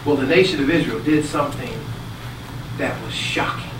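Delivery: ordinary talk, nothing marked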